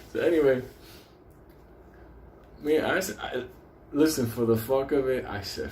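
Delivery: ordinary talk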